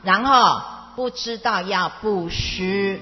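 usual speaking voice

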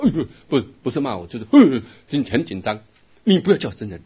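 retold scolding. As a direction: play the friend snapping at you, loud. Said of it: "第二次去他就骂我". (模仿朋友大声责骂)

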